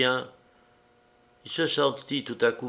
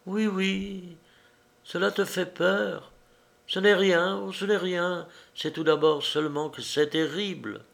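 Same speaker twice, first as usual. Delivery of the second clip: higher, lightly voiced strange young woman; higher-voiced girl, eerily calm; woman's voice; 8 semitones higher than usual. A narrator's reading. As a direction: speak as the odd, intense girl. Woman character speaking.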